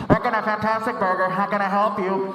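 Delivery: muffled intercom employee; drive-thru speaker. Acted. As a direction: talk like a crackly order speaker. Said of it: "They'll come on the speaker".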